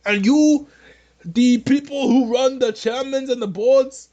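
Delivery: put-on a clumsy send-up of Bernie Sanders' accent, pleading and apologetic; hammed-up Bernie Sanders accent, sorry and submissive; bad Bernie Sanders accent. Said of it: "This is a bad accent".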